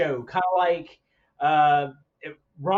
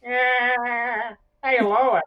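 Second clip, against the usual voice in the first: drawn-out cartoon chuckle voice, pushed up higher; Peter Griffin; 5 semitones higher, 4 dB louder, much slower than usual. Podcaster showing off his favorite cartoon impression, Peter Griffin laugh. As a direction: do the classic cartoon dad laugh. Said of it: "the classic Peter Griffin laugh".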